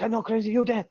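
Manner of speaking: high, loud mock dictator voice; hammed-up angry leader voice; mocking Kim voice. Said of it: "and he's like".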